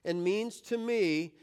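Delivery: slow, measured phrase for others to join; deliberate group recitation with pauses; leading a recitation. Shown as a demonstration. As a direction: lead the group through it phrase by phrase, slowly. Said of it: "Let's do it one more time".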